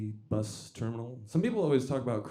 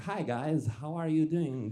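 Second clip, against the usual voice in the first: slightly higher, odd-sounding imitation; peculiar voice; 4 semitones higher than usual. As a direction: imitate an acquaintance's strange voice. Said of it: "He had a very peculiar voice".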